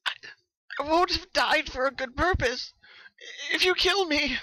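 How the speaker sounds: high, broken, tearful voice; sobbing plea. Acted. Rainbow Dash pleading for her life, sobbing. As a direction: beg through sobs. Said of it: "Rainbow sobbed".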